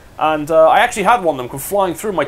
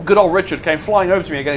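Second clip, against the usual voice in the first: ordinary talk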